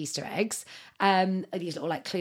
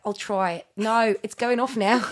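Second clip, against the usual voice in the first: louder put-on Australian accent; 3 semitones higher, 5 dB louder than usual. Shown as a demonstration. Host agreeing to try it, in a put-on Australian accent. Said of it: "quite good in Australian accents".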